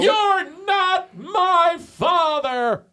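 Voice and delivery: high, very slow drawn-out proclamation; show-title announcement. Pretend. Host announces the podcast's name, drawn-out high proclamation. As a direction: stretch each word like a big title reveal.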